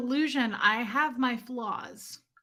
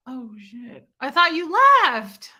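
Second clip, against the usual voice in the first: startled; 2 semitones higher, 10 dB louder than usual. Real, not acted. Caught talking, boyfriend appears.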